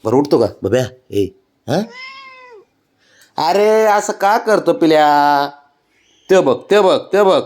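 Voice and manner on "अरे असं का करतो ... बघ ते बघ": high, slow pleading coo. Real, not acted. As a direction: gently plead with the pet. Pleading with stubborn pet.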